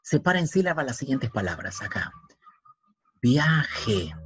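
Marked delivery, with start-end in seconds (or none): 3.2-4.1 "Viaje" in two beats, via, je; "Viaje" spaced out as via - je; syllable by syllable. Shown as a demonstration. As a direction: split "Viaje" with a gap before je. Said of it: "Así, separado".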